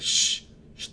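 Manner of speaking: shushing. Retold or imitated. Kid hushing friend while sneaking.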